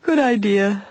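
sleepy agreement through a yawn; stifled yawn. A narrator's reading. Daughter agreeing to go to bed, through a stifled yawn. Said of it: "Nancy stifled a yawn".

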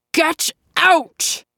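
put-on high, shouted command from the old man; old man's furious outburst; thundering. A narrator's reading. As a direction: bellow him out of the room. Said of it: "Asa thundered".

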